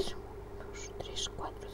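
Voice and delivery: counting stitches in a whisper. Real, not acted. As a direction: breathe the numbers out. Counting stitches under her breath.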